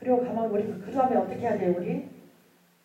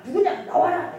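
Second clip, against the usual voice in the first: higher, louder mock confrontation; retold angry demand; 8 semitones higher, 8 dB louder than usual. Accuser calls out whoever told on her, retold angry demand. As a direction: imitate someone loudly demanding the culprit come out. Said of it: "지금 나한테 따진다".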